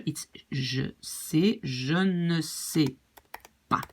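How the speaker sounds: very slow "je sais, je ne sais pas" with ne stressed; slow French demo. Shown as a demonstration. Teacher slowly building the French negation. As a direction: drag out each word. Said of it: "the negation".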